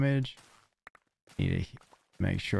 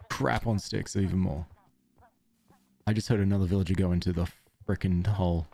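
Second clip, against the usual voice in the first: ordinary delivery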